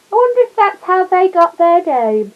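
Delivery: lifted, bright punchline voice; high and loud, teasing wordplay; playful high joke. Acted. Mr Gruber teasing Paddington about goldfish, high playful voice.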